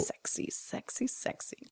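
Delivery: very soft, voiceless whisper; teasing, hushed repetition. Pretend. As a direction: whisper it as a sultry aside. Whispered teasing interjection about the scene.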